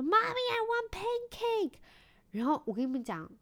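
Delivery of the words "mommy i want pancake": put-on high, loud, slow drawn-out child voice on I want pancake; loud child's demand. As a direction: shout it like an eager little boy. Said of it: "大声说".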